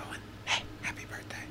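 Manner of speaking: breathy playful whisper. Acted. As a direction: whisper the wish secretly. Whispered birthday greeting.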